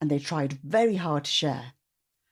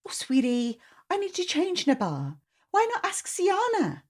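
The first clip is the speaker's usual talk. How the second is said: high, cooing aunt voice; busy auntie, sing-song reply; sweet auntie; 12 semitones higher than usual. Acted. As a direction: coo it to a little girl.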